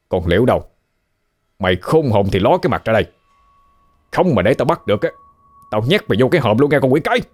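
threatening shout. A narrator's reading. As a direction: bark threats at the hiding girl. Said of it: "Tên Trọc nói lớn". (tên Trọc quát tháo đe dọa, giọng cao dần)

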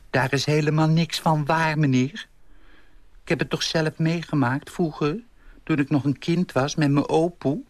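put-on an old lady insisting from her own memory; indignant old lady. (verontwaardigde oude juffrouw)

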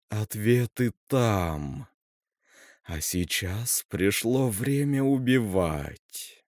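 slow ominous raspy drawl; хрипло тянуть угрозу; медленный зловещий хрип. Acted. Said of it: "хриплым голосом сказала София". (медленно, зловеще, хрипло)

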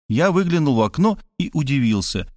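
plain reading voice

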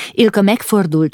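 usual speaking voice

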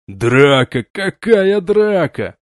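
slow, absent-minded puzzled question; pulled out of his own thoughts; distracted. A narrator's reading. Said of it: "Отвлекаясь от своих мыслей".

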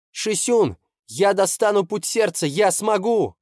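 put-on high, loud, resolute young boy; determined boy. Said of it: "решительно произнёс".

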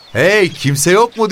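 loud, high-pitched call; shouting. A narrator's reading. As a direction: call out to see if anyone is there. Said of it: "diye bağırdı". (yüksek sesle bağırarak)

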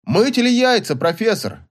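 high-pitched anxious question; lightly acted alarm of a distant caller; worried. A narrator's reading. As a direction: ask anxiously, pitched well up. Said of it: "дальний мужской голос сказал в ухо встревоженно".

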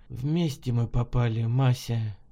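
low, soft, weary remark; subdued and burdened; heavy. A narrator's reading. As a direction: say it heavily, with resignation. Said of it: "тяжело произнесла Белова".

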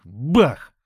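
loud bang. A narrator's reading. Impact sound in the fight.

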